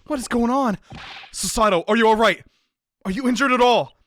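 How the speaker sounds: loud, high, urgent questions; alarmed concern. Acted. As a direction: call out anxiously to a hurt friend. Checking on companion after crash.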